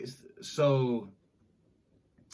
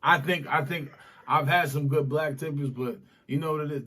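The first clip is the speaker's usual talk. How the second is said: normal talking voice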